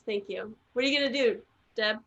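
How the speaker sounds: usual speaking voice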